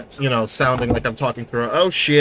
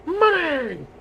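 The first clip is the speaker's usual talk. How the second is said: cartoon voice. Mr Krabs style money call.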